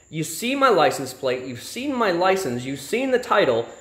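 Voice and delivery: slow, deliberate, spelled-out protest; slow exasperation. Retold or imitated. Driver slowly spelling out the obvious to the trooper. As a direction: lay it out like to a slow child.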